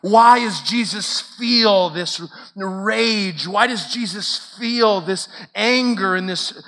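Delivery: high, loud, hammering sermon cadence; impassioned preaching. Real, not acted. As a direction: build insistent repeated statements into forceful questions. Preaches at full force.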